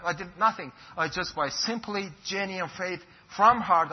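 normal talking voice

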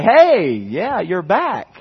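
lightly voiced cheery professor; friendly greeting. Professor greeting the returning pastor, lightly voiced cheery.

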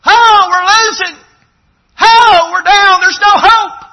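high, loud wailing lament of defeat; despairing churchgoer. Acted. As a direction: cry out like a church that's given up. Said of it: "you catch that vibe".

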